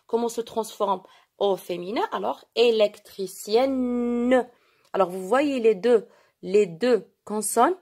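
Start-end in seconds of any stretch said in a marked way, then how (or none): 2.5-4.5 "électricienne" slowly, the n held long into "ne"; drawn-out model word. Shown as a demonstration. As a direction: stretch the ending of "électricienne" to show the doubled n. Teacher models the feminine ending. (« électricienne » lentement, n final allongé)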